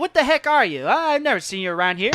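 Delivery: loud, high burst, then quick follow-up; startled challenge. Acted. Son confronting a stranger at his cart, taken aback. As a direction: demand to know who this stranger is.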